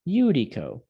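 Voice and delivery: YOO-dee-co, falling at the end; Judico with Y sound. Shown as a demonstration. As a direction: start Judico as if spelt with a Y. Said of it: "makes a Y sound".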